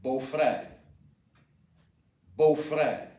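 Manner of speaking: "beau-frère" said bo-FRAIR; careful French "beau-frère"; pronunciation model. Shown as a demonstration. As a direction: sound out "beau-frère" for learners. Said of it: "is pronounced".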